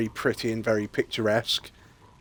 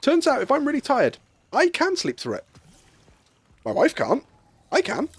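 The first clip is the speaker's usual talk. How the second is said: squeaky high; 13 semitones higher than usual. Real, not acted.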